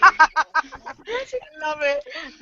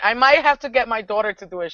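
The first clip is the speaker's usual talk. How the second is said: usual speaking voice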